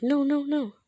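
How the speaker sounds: high, loud pleading protest; acted panic. Student told he is expelled, acted panic. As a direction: blurt a mock-desperate refusal.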